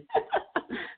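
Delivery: a sudden high burst of laughter. Real, not acted. Guest laughing high at his own story.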